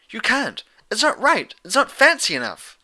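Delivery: high, loud protest; frantic Pinkie. Acted. Pinkie objecting that the spell isn't fancy, high and loud. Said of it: "Pinkie Pie blurted".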